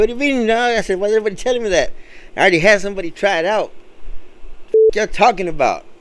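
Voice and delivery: high, incredulous pushback; exasperated disbelief. Retold or imitated. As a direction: demand why everyone says it. Exasperated at repeated complaint.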